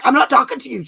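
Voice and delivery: high, loud mock yell at a friend. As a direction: snap back as if annoyed, played for laughs. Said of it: "Sometimes she yells back".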